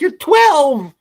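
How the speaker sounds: loud, very high exclamation of disbelief; shocked parent. Retold or imitated. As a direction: re-enact a parent's scandalized outburst. Retelling shocked parent's reply.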